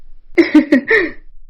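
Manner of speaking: a short knowing chuckle; amused chuckle. Acted. Nargis chuckles, spotting the young pair, amused. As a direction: laugh to yourself at a secret.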